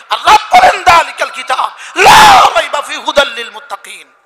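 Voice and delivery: loud, high, impassioned sermon; full-force preacher denouncing false teaching; fiery preaching. Real, not acted. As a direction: thunder it out to the congregation.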